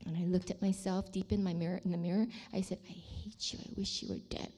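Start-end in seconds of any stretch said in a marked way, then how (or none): 2.9-4.5 whispered self-curse; very soft, voiceless contempt, re-enacted; disgusted self-loathing. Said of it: "with disgust in my eyes".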